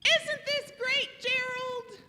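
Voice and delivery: high-pitched gleeful question; delighted Piggy. Acted. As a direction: chirp it high, thrilled with the party. Piggy cheerfully asks absent Gerald.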